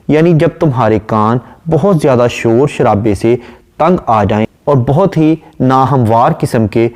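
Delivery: ordinary talk, nothing marked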